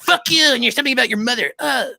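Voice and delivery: high, loud imitation of a raging gamer; mock enraged comeback. Imitating a toxic player answering kindness, hammed-up rage. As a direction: mimic a player flying off the handle.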